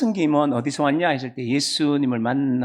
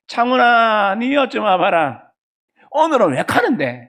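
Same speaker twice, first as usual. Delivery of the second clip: Gyeongsang mother calling; 5 semitones higher, 4 dB louder, slower than usual. Retold or imitated. Mother calls son again.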